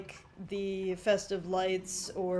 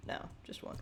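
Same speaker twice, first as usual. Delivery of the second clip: whispered aside; 15 dB quieter, faster than usual. Real, not acted. Answers own question quietly.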